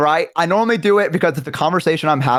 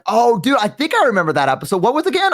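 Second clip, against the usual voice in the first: high, fake-remembering voice; squirming mock recall; weaseling bluff; 8 semitones higher than usual. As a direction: pretend you know the episode. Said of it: "weasel my way through".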